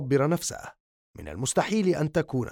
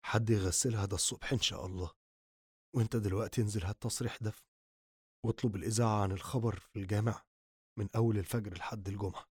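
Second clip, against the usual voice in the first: much lower, much softer, fast; numb and quiet; 5 semitones lower, 11 dB quieter, faster than usual. Acted. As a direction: rattle off orders hollow and quiet. Grieving son gives numb orders.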